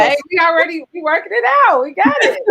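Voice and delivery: high bubbly cut-in with a laugh; excited. Real, not acted. Guest cutting in with excited laughter. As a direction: jump in laughing and eager.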